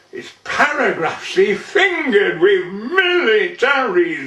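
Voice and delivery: measured, rhythmic dialect monologue; poem recitation. Acted. Man recites an old Burton tale to an audience, theatrical poem recitation.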